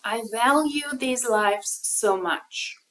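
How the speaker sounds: slow, drawn-out sarcastic parody of her; mocking echo. Commentator parroting the influencer, slow and sarcastic. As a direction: stretch it out to ridicule the words.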